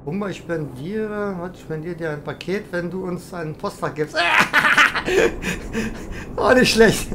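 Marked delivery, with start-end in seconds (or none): 4.2-6.3 breathy high burst of laughter. Real, not acted. Laughing at a viewer's gift offer, bursting into laughter. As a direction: laugh out at the chat's joke.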